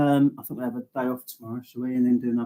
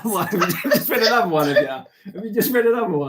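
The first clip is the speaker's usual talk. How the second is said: laughing; 8 semitones higher, faster than usual. Real, not acted. Teasing while laughing.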